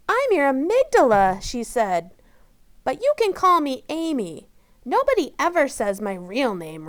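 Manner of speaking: put-on bouncy small-girl voice; little girl Amy. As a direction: amygdala girl explaining herself.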